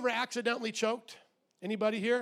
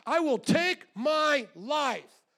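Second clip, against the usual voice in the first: angry defiance; 6 semitones higher, 5 dB louder, much slower than usual. Retold or imitated. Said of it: "people are so angry with God".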